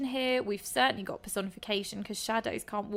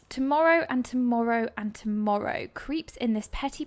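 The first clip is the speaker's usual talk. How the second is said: louder, weighty reading; dramatic recitation; 5 dB louder than usual. Acted. Teacher rereads the famous line aloud to the class, declaiming. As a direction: lean into the repetition.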